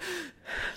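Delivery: short, soft, audible sigh of breath; demonstrated breath catch. Shown as a demonstration. Yoga teacher shows being out of breath, demonstrated breathy sigh. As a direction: briefly act out needing to catch your breath. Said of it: "you're panting".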